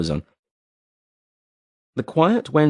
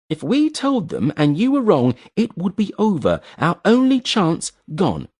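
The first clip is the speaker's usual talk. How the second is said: higher, fierce woman's voice, slowing on the stakes; challenging Bellis; 11 semitones higher, 3 dB louder than usual. A narrator's reading. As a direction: she dares him to risk it.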